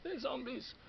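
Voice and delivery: loud exasperated insistence; exasperated shout. Real, not acted. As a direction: burst out, fed up. Insisting they are zombies.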